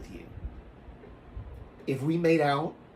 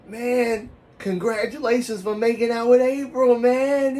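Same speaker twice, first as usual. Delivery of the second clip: loud, high-pitched mock congratulations; hyped congratulations; 9 semitones higher, 7 dB louder, slower than usual. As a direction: imitate an excited buddy, high and loud. Schoolmate congratulates him, acted.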